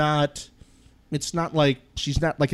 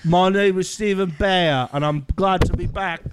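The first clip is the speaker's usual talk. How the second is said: imitating Bear; 5 semitones higher than usual. Host imitates the cast member Bear's intro in British accent. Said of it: "That was amazing".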